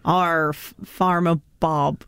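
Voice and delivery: put-on West Country accent. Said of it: "I'm being West Country".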